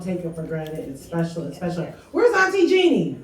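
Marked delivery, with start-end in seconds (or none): 2.1-3.2 loud excited calling; cheering her on proudly; proud tears. Real, not acted. Calling up Auntie Jeannie, cheering.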